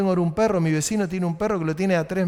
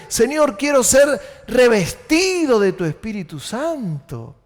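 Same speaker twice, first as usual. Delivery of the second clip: shouted prayer, slower, higher; 4 semitones higher than usual. Shown as a demonstration. Preacher voicing a believer's prayer, shouted. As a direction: cry out to the Lord.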